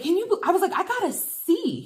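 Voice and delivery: high-pitched mock distress over the grade; whining to the boyfriend about the C; upset whine. Telling her boyfriend about the C in a high, upset whine.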